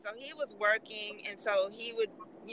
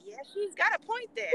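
flight attendant agreeing; 11 semitones higher than usual. Retold or imitated. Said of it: "the flight attendant was like".

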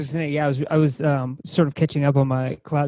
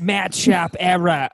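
slow Boston accent, Sharp said as Shahp; 3 semitones higher, 3 dB louder, much slower than usual. Shown as a demonstration. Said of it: "That's my Boston accent coming out".

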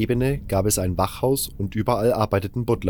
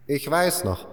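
put-on higher old-man voice recalling the past; old miner reminiscing; 6 semitones higher than usual. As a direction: tell it like a veteran who survived it all.

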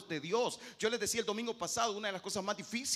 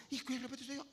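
usual speaking voice

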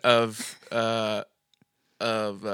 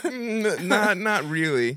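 mock clerk dismissal; 7 semitones higher, much slower than usual. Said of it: "He's just like".

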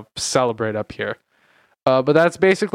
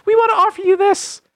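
high squeaky corporate offer; mock company voice; 4 dB louder than usual. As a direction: pitch it up like a fawning company. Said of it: "every single company will come after you and be like".

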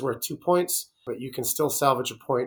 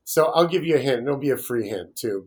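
ordinary talk

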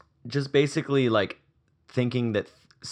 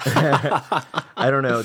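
slow, louder, laughing; 5 semitones higher, 7 dB louder, much slower than usual. Real, not acted. Cohost laughing at a friend's opening-night plan, slow laughing. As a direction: laugh the words out slowly.

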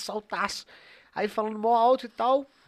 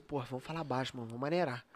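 hushed warning; 8 semitones lower, 11 dB quieter, faster than usual. Retold or imitated. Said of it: "Aí o Bico".

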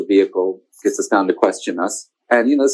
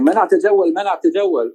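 mock megaphone announcement; 7 semitones higher than usual. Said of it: "screaming something on a megaphone".

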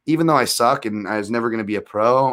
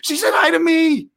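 much higher pleading protest; defensive whine; 3 dB louder than usual. Retold or imitated. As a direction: plead innocence in a high whine.

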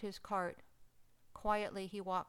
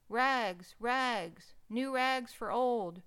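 loud, drawn-out ragman's cry, a touch higher; street vendor's call; 4 semitones higher, 7 dB louder, much slower than usual. Acted. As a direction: belt it out like a vendor hawking rags. Ragman calling his trade.

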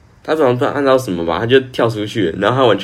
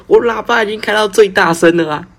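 high-pitched, louder agitated teacher imitation; protesting the speakers are at full volume; 11 semitones higher, 4 dB louder than usual. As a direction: mimic the flustered teacher insisting, voice jumping up. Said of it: "国语老师就很激动说".

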